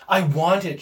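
usual speaking voice

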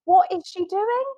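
high, rising panicked questions; worried witch colleague asks what's wrong; alarmed colleague. Retold or imitated. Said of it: "colleague who's the wicked witch is like".

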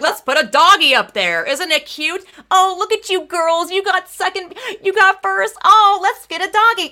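high, cooing, mock-cutesy voice; mocking sweet organizer. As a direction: coo it like talking down to little girls. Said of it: "Being like".

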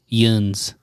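"yuns" as one long, drawn-out syllable; Western Carolina dialect word. Shown as a demonstration. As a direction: stretch "yuns" out slowly. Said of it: "like, Western North Carolina, they say".

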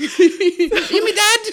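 slow, loud, very high squeak; little scamp. Pretend. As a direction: plead like a squeaky urchin. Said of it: "can I hear it as a scamp".

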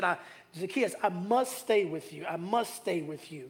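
Jesus earnestly to Zacchaeus; tender plea. Retold or imitated. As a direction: say it gently, almost private. Tender closing of Jesus' words.

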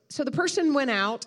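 ordinary talk

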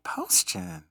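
high, surprised question; surprised woman. Retold or imitated. Woman asking his brother if he knows Connie, surprised. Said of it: "And she said".

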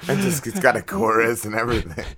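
usual speaking voice

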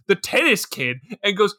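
normal talking voice

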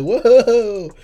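loud, high startled whoa. Retold or imitated. Boyfriend startled by girlfriend, loud high whoa. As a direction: jump back in alarm.

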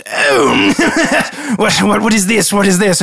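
high, amused startled lordly voice; haughty lord. Acted. Lord reacting to the kneeling guard, haughty and startled.

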